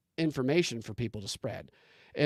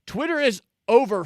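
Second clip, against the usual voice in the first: all caps shout; 8 semitones higher, 8 dB louder than usual. Retold or imitated. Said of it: "in all caps".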